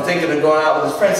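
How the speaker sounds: ordinary talk, nothing marked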